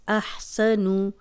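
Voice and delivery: احسن with a clear breathy ح, not آ; slow recited demo. Shown as a demonstration. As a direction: recite احسن slowly, sound the ح. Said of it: "ہا کی آواز آنی چاہیے". (احسن، حا کی صاف آواز کے ساتھ)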